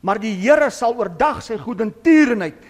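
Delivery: ordinary talk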